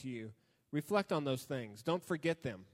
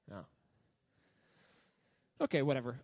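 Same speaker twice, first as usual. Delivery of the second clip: usual speaking voice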